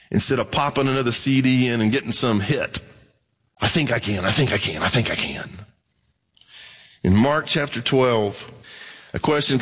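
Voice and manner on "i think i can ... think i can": mock the little-engine self-talk; a sing-song mantra dying away. Mocking self-help mantra.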